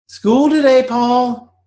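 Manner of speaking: high, clipped mother calling her son; high-pitched mother announcing school; mother's voice. Acted. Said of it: "that day she said".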